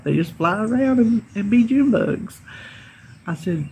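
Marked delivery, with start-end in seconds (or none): 0.0-2.4 higher, laughing as she tells it; amused, laughing retelling. Real, not acted. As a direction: let the giggle lift the words.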